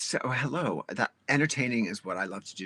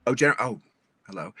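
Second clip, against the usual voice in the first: ordinary delivery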